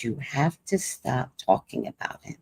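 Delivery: low, quiet, firm warning; calm, quiet retold warning; stayed calm. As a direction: keep it hushed and steady, holding back anger. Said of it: "I still stayed calm".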